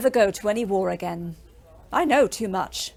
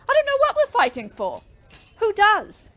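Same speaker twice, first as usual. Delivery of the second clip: furious cry; 11 semitones higher than usual. Acted. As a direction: let the anger break out. Bitter quarrel about the war.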